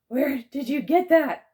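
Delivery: high, loud, slow frightened cry. A narrator's reading. Peyton cowering behind narrator, frightened cry. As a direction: gape and cry out in fear.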